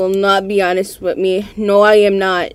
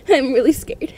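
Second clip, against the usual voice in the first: nervous laugh; 5 semitones higher than usual. Real, not acted. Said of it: "I am scared".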